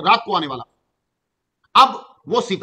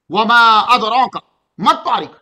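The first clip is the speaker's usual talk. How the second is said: Quran recitation; 6 dB louder than usual. Acted. Recites next verse.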